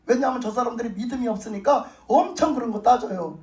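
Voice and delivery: usual speaking voice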